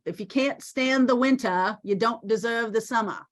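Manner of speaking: playful try at a Maine accent; best homespun Maine accent, dropping the R's. Shown as a demonstration. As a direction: put on a Down East Maine accent. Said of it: "That's my best Maine accent".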